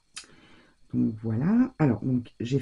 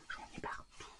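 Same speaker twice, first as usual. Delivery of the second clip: breathy whisper of dismay; 23 dB quieter than usual. Real, not acted. Crafter repeats her mess to viewers, whispered. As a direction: whisper it like a secret annoyance.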